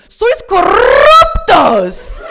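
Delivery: slow, high, full-throated scream into the phone; furious scream. Retold or imitated. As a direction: scream the accusation, dragging it out. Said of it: "I screamed into the phone".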